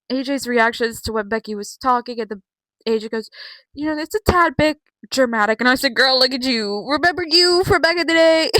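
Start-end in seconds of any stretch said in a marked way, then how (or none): 3.7-5.6 high, loud mock put-down. Vlogger mimicking A J chiding Becky, raised-pitch jab. 5.9-8.5 loud, high incredulous comeback; teasing call-out. Retold or imitated. Fan talking back to A J, loud teasing call-out. Retelling own shouted comeback at screen.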